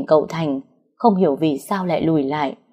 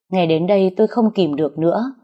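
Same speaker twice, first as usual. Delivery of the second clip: ordinary delivery, nothing marked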